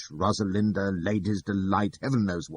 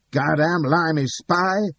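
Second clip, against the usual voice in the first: slow, loud, high angry shout; growling; 10 semitones higher, 7 dB louder, slower than usual. Acted. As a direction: bark it out like a hostile heckler. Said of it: "an unseen voice growling".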